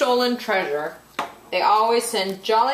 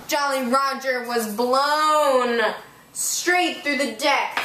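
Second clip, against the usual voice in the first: plain reading voice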